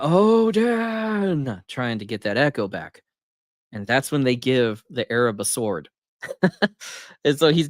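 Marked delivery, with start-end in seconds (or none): 0.0-1.6 high, ringing call of Odin into the fog; a mock call, pitched well up and carried. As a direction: call the name out so it echoes back. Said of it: "he's calling out".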